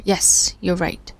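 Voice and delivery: ordinary talk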